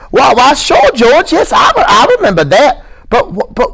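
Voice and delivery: very high, eager stammer; smiling excited high voice; delighted Lenny. Acted. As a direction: burst out happily like a child. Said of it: "face broke into a delightful smile".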